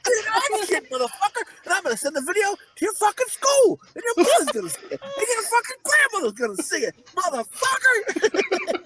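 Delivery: very high, hammed-up ranting; mock furious tirade. Co-host as Slapdog threatening to shame the kid, mock fury.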